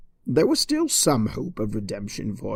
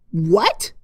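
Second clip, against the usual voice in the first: a louder, higher "what"; sharp shout; 5 semitones higher, 5 dB louder than usual. Acted. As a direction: snap back loudly. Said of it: "shouted Twilight".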